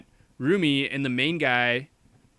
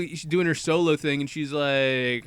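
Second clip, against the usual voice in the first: ordinary delivery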